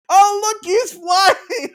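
a giddy goblin shouting with glee; gleeful goblin. Retold or imitated. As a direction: cheer like a cruel goblin. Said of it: "the goblin that I was talking to".

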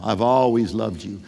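tender voice of God. Acted. God speaking love softly over his listener. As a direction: let it settle low and gentle, like a promise. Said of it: "I want you to hear God speaking this over you".

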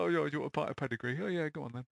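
offhand pub-mate impression; unimpressed mates. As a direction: imitate indifferent mates, tossed off quickly. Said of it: "And they're just like".

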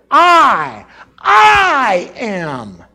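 loud, booming "I, I am"; "I, I am" thundered out; emphatic I am. Shown as a demonstration. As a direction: proclaim "I, I am" with full force. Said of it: "which is very emphatic".

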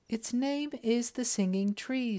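put-on high, gentle young princess voice. A narrator's reading. Princess guiding the Sultan to the tree, high and gentle. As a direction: speak up lightly as the graceful princess.

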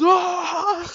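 high shouted roar, breaking into a laugh; mock Hulk scream while laughing. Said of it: "he goes".

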